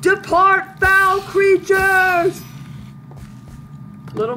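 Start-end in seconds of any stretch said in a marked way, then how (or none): 0.0-2.4 high, loud theatrical defiance; mock-heroic bellow. Hero banishing the foul creatures, grandiose shout. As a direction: bellow it like a grand hero, stretching the last words. Heroic challenge to attacking spiders.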